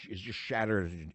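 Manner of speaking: usual speaking voice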